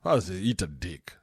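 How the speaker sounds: ordinary talk, nothing marked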